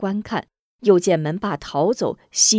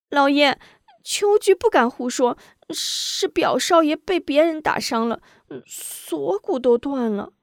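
put-on high servant girl's voice; high, fearful young maid; timid maid; 8 semitones higher than usual. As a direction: answer the master meekly. Maid answers master.

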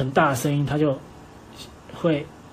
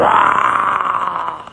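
loud shouted roar; lion roar shout; 3 semitones higher, 6 dB louder than usual. Acted. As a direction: let out a big mock roar. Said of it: "狮吼功就用嘴巴发出声音". (大声吼叫)

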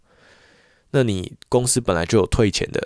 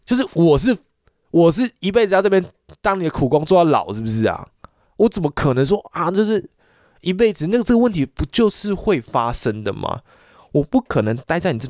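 real anger; 6 semitones higher than usual. Real, not acted. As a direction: pitch it way up in exasperation. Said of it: "我每次讲这个我都很生气".

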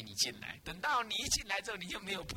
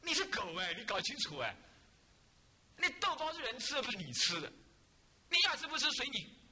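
higher, sharp telling-off of a dog; scolding the dog; 6 semitones higher than usual. Retold or imitated. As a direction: scold the dog as if it is in front of you. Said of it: "我就骂他说". (骂狗的语气，音调偏高)

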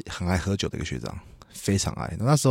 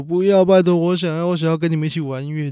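higher, louder mock sobbing plea; retold crying voice, pitched up and pleading; imitating crying plea; 8 semitones higher, 5 dB louder than usual. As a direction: act out a tearful beg, half-hammed. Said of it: "然后他在那边哭说".

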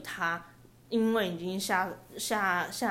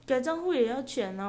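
scammer explaining; 6 semitones higher than usual. Retold or imitated. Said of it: "然后姐姐就开始说".

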